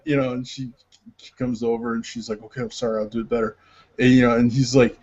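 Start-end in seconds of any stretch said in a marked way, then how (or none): 2.4-3.6 soft, low apology; meek apology. Retold or imitated. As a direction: imitate a chastened actress, softly. Actress apologizes to director in sketch.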